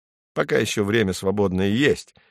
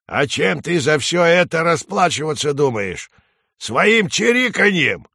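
higher-pitched, taunting crow; scolding crow; 7 semitones higher, 3 dB louder than usual. A narrator's reading. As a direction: press the question as a nagging crow. Crow presses sparrow over debts.

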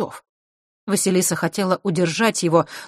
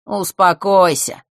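slow, lazy drawl; bored, unhurried reply; 3 semitones higher, 6 dB louder, slower than usual. A narrator's reading. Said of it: "лениво отозвался".

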